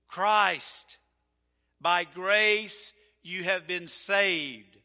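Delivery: slow, loud solemn reading; scripture reading. Acted. Preacher reading Ephesians to the church, slow and solemn.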